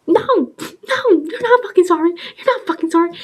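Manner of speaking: high, crying yell; retold tearful fury; tearful angry. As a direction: shout through tears.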